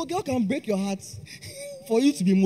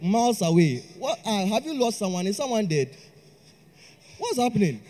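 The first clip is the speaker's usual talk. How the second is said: usual speaking voice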